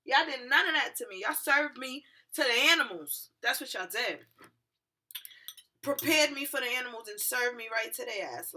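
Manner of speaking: high, loud, indignant complaint; mad at cops. Retold or imitated. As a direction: berate them with wounded outrage, rising in volume. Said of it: "I was just still so mad at the cops".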